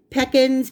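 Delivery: "Pecans" said PECK-ens, stress up front. Shown as a demonstration. Baker offering pronunciations to viewers, "Pecans" as PECK-ens. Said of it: "however you pronounce it".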